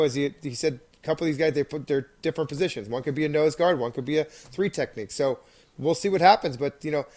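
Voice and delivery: ordinary delivery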